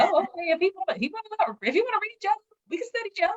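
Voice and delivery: way up high, sing-song playing along; hammed-up eager girlfriend, very high; mock cheerful agreement. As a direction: chirp it like a perky yes-woman. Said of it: "So I was like".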